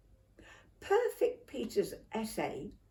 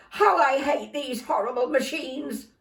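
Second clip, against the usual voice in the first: louder, higher fed-up complaint; exasperated; 7 semitones higher, 7 dB louder than usual. Acted. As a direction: vent at the broken machine. Mum furious at computer.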